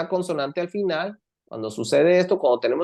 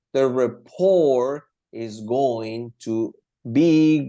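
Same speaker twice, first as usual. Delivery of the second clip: very slow, stretched-out words; slow example reading; 3 semitones lower, much slower than usual. Shown as a demonstration. Teacher showing going to be in a sentence, very slowly. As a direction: spell out the structure word by word.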